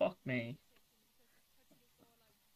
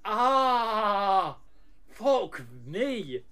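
drawn-out startled cry; shocked yell; 5 semitones higher, much slower than usual. Real, not acted. Jumpscare reaction.

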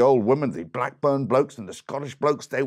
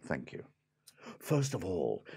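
usual speaking voice

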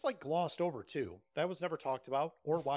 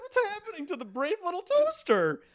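mock panic; 13 semitones higher, 6 dB louder than usual. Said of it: "I'm like".

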